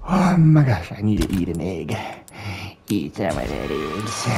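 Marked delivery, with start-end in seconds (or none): 0.1-0.9 sharp gasp then loud exclaim; startled gasp. Real, not acted. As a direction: gasp in shock.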